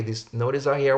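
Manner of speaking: ordinary talk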